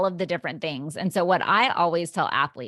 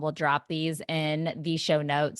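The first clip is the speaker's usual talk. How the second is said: ordinary talk, nothing marked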